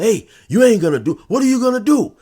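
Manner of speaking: loud, high mocking dare; taunting tough guy. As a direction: taunt him to shoot. Knife guy taunting at gunpoint.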